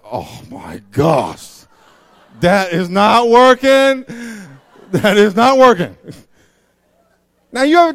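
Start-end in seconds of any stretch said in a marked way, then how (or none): 0.0-6.0 very loud, slow horrified reaction; dismayed reaction. Retold or imitated. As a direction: friend sees the bad dress. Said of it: "you're like".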